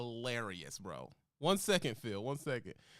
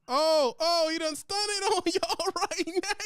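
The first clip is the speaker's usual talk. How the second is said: excited; 5 dB louder, slower than usual. Real, not acted. Host erupts at a burn.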